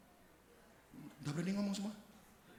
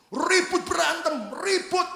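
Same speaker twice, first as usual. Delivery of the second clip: heated storytelling; 12 semitones higher, 9 dB louder, slower than usual. Retold or imitated. Narrates a fierce family quarrel.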